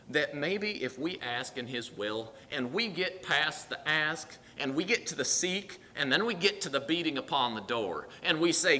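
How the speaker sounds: ordinary delivery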